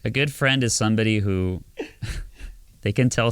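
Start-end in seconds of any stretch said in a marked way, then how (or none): none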